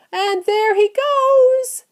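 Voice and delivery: very high, drawn-out holler; loud, slow, high cry; shout. A narrator's reading. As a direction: shout it out to the crowd, pointing upwards. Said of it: "somebody shouted".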